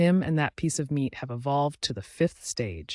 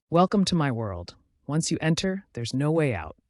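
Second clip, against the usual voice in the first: plain reading voice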